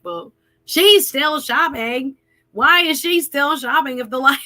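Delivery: mock the line-cutter's excuse; line-cutter's retort. Man imitating the line-cutter's excuse, mildly mocking.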